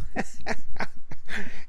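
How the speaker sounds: a burst of real unvoiced laughter; breathy laugh. Real, not acted. Storyteller cracking up at his friend's joke, laughing breathily.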